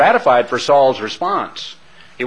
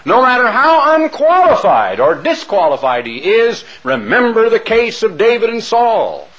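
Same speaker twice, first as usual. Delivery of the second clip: raised pulpit voice, higher and a bit louder; mock preacher; 7 semitones higher, 4 dB louder than usual. Imagined preacher telling the congregation to obey, mock pulpit fervor. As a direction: parody a minister defending a fallen leader. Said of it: "and saying".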